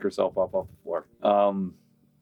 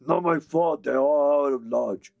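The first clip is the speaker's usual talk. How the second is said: higher, defensive dopey orc; 6 semitones higher than usual. Acted. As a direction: protest like a sulky brute. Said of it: "the other one says".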